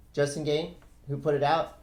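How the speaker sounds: ordinary talk, nothing marked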